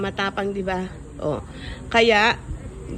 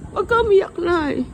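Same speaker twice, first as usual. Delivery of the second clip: much higher, coaxing plea not to cry; pleading comfort; 9 semitones higher than usual. Real, not acted. Child begs crying mother to stop, high coaxing plea. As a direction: beg her tenderly to stop crying.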